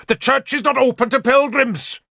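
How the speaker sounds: high-pitched angry snapping; furious snap. A narrator's reading. Bishop turning on a rain-soaked newcomer, high furious snap. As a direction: snap it at the intruder. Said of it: "the bishop snapped".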